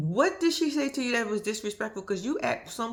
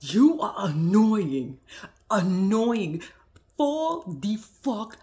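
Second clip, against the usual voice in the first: slow, drawn-out, louder scold; telling Donna off; 5 dB louder, much slower than usual. Retold or imitated. Vlogger retelling what she told Donna, slow drawn-out louder scold. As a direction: drag every word out at her. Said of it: "I said".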